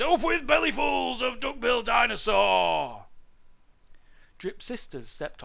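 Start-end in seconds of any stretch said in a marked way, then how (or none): 0.0-3.1 high, loud war chant; full-voiced tyrannosaur shout. A narrator's reading. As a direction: bellow the hunting slogan. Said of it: "they shouted".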